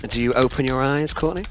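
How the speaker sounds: ordinary talk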